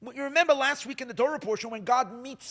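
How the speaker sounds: normal talking voice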